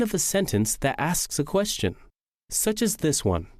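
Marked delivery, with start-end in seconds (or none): none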